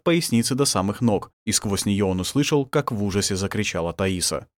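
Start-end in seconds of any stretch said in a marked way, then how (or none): none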